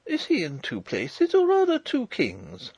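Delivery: puzzled question, leaping high; bewildered. A narrator's reading. Princess puzzled by talk of two kings, bewildered. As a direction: ask in high-pitched bewilderment.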